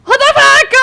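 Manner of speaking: high, full-volume shriek of disbelief; loud, shocked outcry; panicked scream. Real, not acted. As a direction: scream it in real alarm. Panicking as things go wrong.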